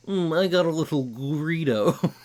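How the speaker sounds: smug nerd proud of his pun. Acted. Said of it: "I love doing my nerd voice".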